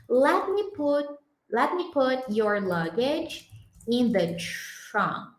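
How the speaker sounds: "let me put" slowly with lemme linked; slow model sentence. Shown as a demonstration. Teacher models the English translation slowly for the class. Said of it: "Deixa eu colocar a sua bagagem".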